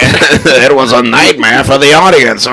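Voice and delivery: high, hammed-up critic's punchline; Gene Shalit. Co-host capping a Nightmare joke, Gene Shalit impression. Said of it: "write a whole list of these".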